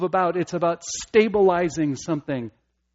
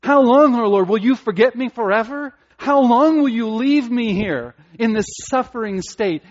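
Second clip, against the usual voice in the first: loud, high pleading lament; psalmist's cry; 7 semitones higher, 7 dB louder than usual. Retold or imitated. Preacher voicing the psalmist's anguished plea, loud and high. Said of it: "as the psalmist says".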